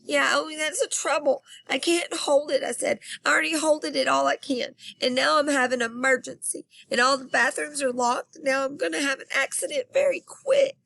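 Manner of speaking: high, fast, lightly tearful plea; a touch of tears in a high rushed whine; mock crying kid. Girl near tears telling the custodian her emergency, high. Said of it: "then I started to cry".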